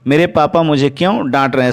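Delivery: usual speaking voice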